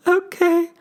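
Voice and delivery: very high, small okay; tiny okay. Retold or imitated. Kid accepting dad's answer, small high voice. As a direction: squeak it like a crushed kid.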